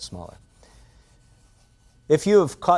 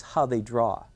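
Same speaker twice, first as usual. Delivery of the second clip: usual speaking voice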